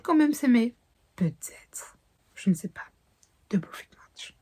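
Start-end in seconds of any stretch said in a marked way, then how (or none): 1.1-2.8 soft teasing whisper. Real, not acted. Booktuber teasing whether the couple will love, whispered. 3.5-4.4 soft mysterious whispered title. Real, not acted. Booktuber closing her book pitch, whispered title.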